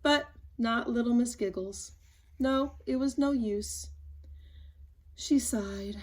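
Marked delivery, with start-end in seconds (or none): 5.2-6.0 breathy whisper. A narrator's reading. Narrator telling of her sigh, whispered.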